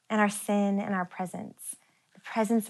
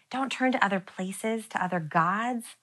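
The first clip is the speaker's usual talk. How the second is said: ordinary delivery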